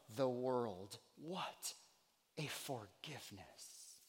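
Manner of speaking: soft, low and reverent; quiet, weighty preaching; hushed awe. Real, not acted. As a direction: let it fall away in wonder. Preacher drops to awed hush.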